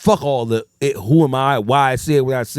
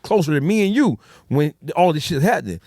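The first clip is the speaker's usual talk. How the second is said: ordinary delivery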